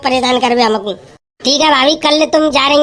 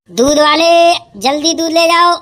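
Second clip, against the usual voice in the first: sing-song hawker's call; street vendor's call. Acted. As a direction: call out your wares down the lane. Milkman hawking along the street.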